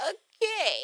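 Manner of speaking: high, stretched-out okay; exaggerated high-pitched backing off; mock taken aback. Brushed off by busy friend, mock taken aback.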